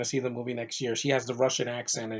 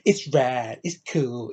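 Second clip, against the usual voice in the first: lightly put-on kid voice, first bit louder; a hint of a kid being impressed; quoting a kid; 3 dB louder, slower than usual. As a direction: toss it off like an excited boy. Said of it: "There's a scene, it's like".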